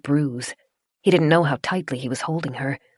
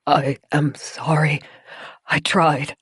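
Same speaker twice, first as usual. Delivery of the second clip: weak, slow gasp; slower than usual. Acted. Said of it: "a gasp through chattering teeth".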